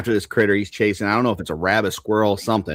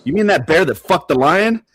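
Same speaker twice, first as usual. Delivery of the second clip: disguised bear; 7 semitones higher, 5 dB louder than usual. Acted. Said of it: "the bear pulls down the paper".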